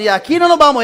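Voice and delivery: high, loud imitated insistence; insistent demand. Familia insiste otra vez. (exigencia firme, aguda y fuerte)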